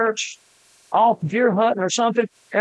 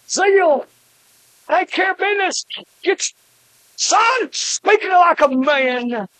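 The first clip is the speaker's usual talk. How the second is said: fired-up preaching; 8 semitones higher than usual. Acted. Preacher urging men, rising pitch.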